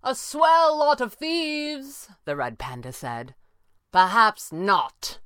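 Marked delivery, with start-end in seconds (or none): 0.0-2.1 slow, high-pitched taunt; mocking. A narrator's reading. Panda mocks from above after laughter. 3.9-5.2 slow, raised reply; calling up. A narrator's reading. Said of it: "Pavli called".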